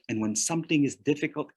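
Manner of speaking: normal talking voice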